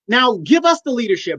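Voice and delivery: usual speaking voice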